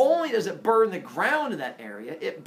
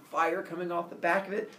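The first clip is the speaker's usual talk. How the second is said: usual speaking voice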